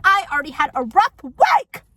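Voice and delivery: loud, pitch leaping at the end; exasperated outburst. Acted. Vlogger ranting back at the neighbor, exasperated outburst. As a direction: complain at full volume.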